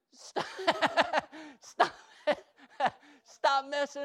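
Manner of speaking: laughing through a slow command; slow, laughing, playful scold. Real, not acted. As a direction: crack up while telling them to stop. Laughing at himself mid-command.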